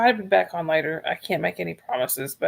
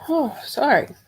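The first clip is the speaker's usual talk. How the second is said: yawning; 8 semitones higher, slower than usual. Real, not acted. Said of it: "Can't stop yawning".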